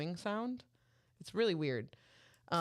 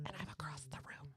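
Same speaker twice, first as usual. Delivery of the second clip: very soft, toneless imitated whisper; mock whisper; 2 semitones lower, 13 dB quieter than usual. Child across the room from parents, mock whisper. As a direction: keep mimicking the barely audible talk. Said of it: "you are basically whispering now".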